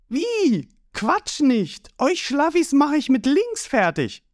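high, cocky, excitable voice; high-pitched boastful young hero; Ruffy. A narrator's reading. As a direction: fire back high and brash.

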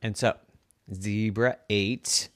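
ordinary delivery, nothing marked

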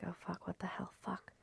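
put-on hushed, breathy curse of a startled girl; startled whisper. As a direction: whisper it as if scared someone hears. Bella startled by noise at night.

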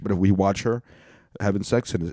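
normal talking voice

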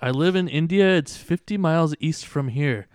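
high, loud imitated Indian accent; Indian woman accent. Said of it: "he did this Indian woman accent".

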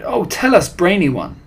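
high, fawning, loud plea; begging cry. A narrator's reading. Witches begging their brainy leader to tell, eager pleading. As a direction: implore the leader adoringly. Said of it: "they cried".

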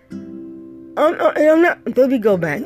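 ordinary talk

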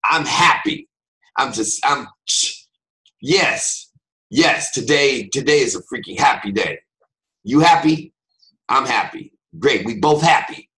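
loud, snapped claim of being happy; angrily happy. Acted. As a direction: insist you're happy like you're furious. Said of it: "they look angry".

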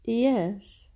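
drawn-out yes in a funny voice; odd put-on voice. Co-host answering the host's sign-off, odd put-on voice. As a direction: put on a silly podcast voice. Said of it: "Why'd you say it like that?".

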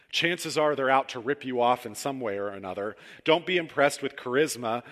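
fervent sermon delivery; preaching. Acted. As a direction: drive the warning home from the pulpit. Reading Peterson's paraphrase with fervour.

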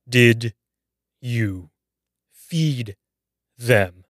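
put-on old neighbour shouting down the phone at the frightened girl; shouted question. A narrator's reading. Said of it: "Missus Riley shouted".

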